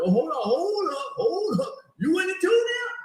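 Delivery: high, loud hold up with rising disbelief; mock outrage. The roasted person turning on a laugher, indignant. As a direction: act offended someone joined in.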